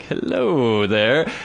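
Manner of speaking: slow, drawn-out greeting; smitten dog. Retold or imitated. Dog staring through the glass, smitten greeting. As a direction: stretch it out like an infatuated dog.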